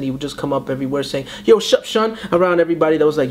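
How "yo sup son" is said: high, easy New York greeting; New York accent. Retold or imitated. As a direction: toss off a New York street hello. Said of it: "he had the New York accent".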